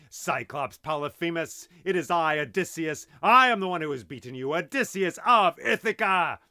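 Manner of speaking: yelled boast. Acted. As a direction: bellow it across the sea. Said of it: "I yelled once again".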